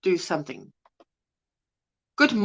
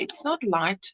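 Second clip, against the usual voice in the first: softer retry; 5 semitones lower, 9 dB quieter than usual. Shown as a demonstration. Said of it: "how do you say?".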